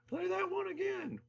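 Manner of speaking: high, put-on fan voice; pretend fan request. Fan asking the band for a reprise, high mock call.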